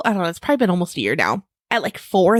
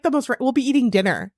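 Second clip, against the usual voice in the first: normal talking voice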